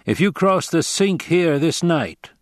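a grave stranger giving a warning; Fremen warrior. Acted. Narrator voices the Fremen.

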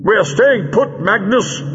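high, defiant shout. A narrator's reading. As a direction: shout the refusal across the room. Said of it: "shouted the Doctor".

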